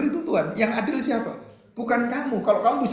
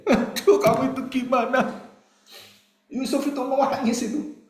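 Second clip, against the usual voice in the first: high, startled mock scold; mock exasperation; 8 semitones higher than usual. Preacher teasing Yusuf for crying, high mock exasperation. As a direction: throw up your hands at Yusuf.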